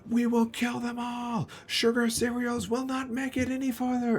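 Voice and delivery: very high, cartoonish war cry; a tiny mob of vegetables howling for battle; screaming broccoli horde. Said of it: "they start to scream".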